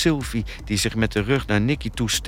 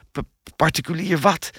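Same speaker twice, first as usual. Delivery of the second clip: higher, stuttering disbelief; incredulous, pitched-up question; baffled stammer; 6 semitones higher than usual. A narrator's reading. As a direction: trip over the word, puzzled.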